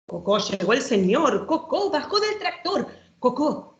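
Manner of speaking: playful, sing-song read-aloud with bouncing rhyme; reading to children. Acted. Showing a children's book to the audience, lively storytime reading.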